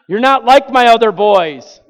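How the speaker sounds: loud, high wail of a scolding mom; imitated motherly outburst. Mother comparing her son to his brothers, loud high retold outburst. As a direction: cry it out like an upset mother.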